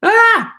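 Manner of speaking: sharp high cry of pain; a short loud yelp as the numb leg twinges; sudden high-pitched ah; startled yelp. Real, not acted.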